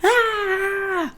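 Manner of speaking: high mock baby wail; fake crying wail. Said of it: "like, fake crying".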